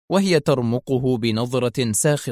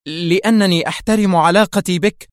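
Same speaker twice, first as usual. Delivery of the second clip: raised, higher-pitched sharp insistence; 6 semitones higher, 5 dB louder than usual. A narrator's reading. Woman hastily replaces a confession with respect, sharply. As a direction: rush to cover the slip, loud and sharp. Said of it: "تندفع مكملة في حدة".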